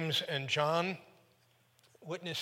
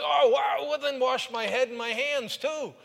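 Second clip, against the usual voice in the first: much higher and louder, comically eager; overeager gush; 9 semitones higher, 10 dB louder than usual. Retold or imitated. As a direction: overdo it like a puppy. Peter overeager at foot washing.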